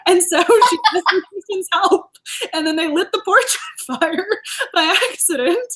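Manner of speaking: words broken by real laughter; laughing through it. Real, not acted. Retelling the porch fire to her co-host, laughing. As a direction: giggle through the story of the fire.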